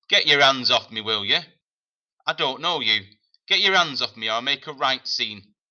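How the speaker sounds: a cornered evacuee shouting, loud and high; angry boy. Acted. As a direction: yell at her to let go. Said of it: "eyeing her angrily".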